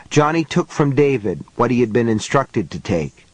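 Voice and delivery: usual speaking voice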